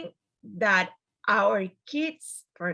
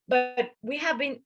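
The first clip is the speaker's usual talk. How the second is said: ordinary talk, nothing marked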